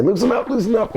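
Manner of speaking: high retold plea; alarmed protest. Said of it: "Coco was saying".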